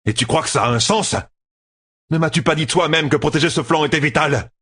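high-pitched, heated accusation; incredulous challenge. A narrator's reading. Commander reminds general the flank was vital, heatedly. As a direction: challenge the excuse, pitch climbing.

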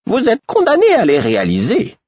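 gently. Acted. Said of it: "ajouta Caudron avec douceur".

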